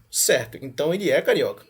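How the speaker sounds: ordinary talk, nothing marked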